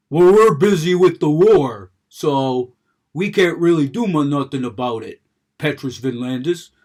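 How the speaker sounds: guard voice. Retold or imitated. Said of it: "and he's like".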